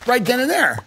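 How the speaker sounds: normal talking voice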